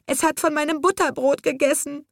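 put-on high little-girl voice, tearful; little girl's voice. A narrator's reading. Little girl explains why the squirrel is hers, tearful and high. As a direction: plead like a small child. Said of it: "schluchzte ein kleines Mädchen".

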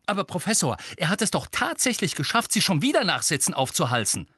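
high-pitched, exasperated complaint; agitated protest. A narrator's reading. Student complains to teacher about Harry's new detention, agitated. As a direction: protest the injustice in a heated rush.

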